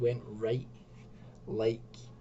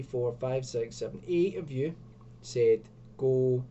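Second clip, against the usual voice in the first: normal talking voice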